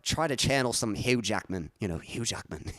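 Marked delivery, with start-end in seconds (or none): 1.2-2.6 amateur Aussie accent, channelling an actor; shaky-quality attempt at an Australian accent. Shown as a demonstration. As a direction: have a rough go at sounding Australian. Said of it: "that's pretty awful, Australian accent".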